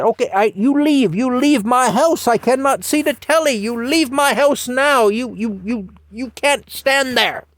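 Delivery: put-on high, loud older British lady shooing a child; Mattie shooing the kid. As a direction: fuss at the kid blocking her telly. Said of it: "So finally she said".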